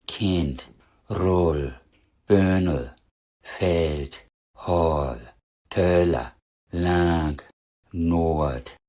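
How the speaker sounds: Kind, Rull, Böhnel, Feld, Holl, Töller, Lang, Nord with stretched vowels; drawn-out falling vowel in each word, as in Kind and Nord; correct half-long vowels. Shown as a demonstration. Teacher repeats the list correctly, half-long demo vowels. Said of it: "Jetzt nochmal richtig".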